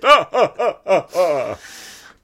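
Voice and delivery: loud, high, hammed-up Dracula laugh; mock vampire cackle. Dracula closing his advert, mock evil laugh.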